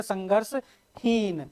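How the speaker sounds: संघर्षहीन drawn out very slowly and softly; slow, quiet teacher's stress on संघर्षहीन; stretched key term. Shown as a demonstration. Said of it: "very important है".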